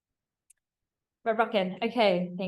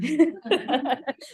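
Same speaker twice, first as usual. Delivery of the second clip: embarrassed laugh; 6 semitones higher, 7 dB louder than usual. Real, not acted. Laughs at own fumble.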